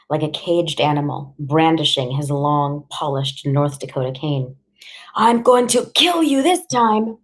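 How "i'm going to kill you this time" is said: high-pitched furious father; enraged threat. A narrator's reading. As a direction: threaten her in a raised, angry voice. Enraged father threatens mother in quarrel.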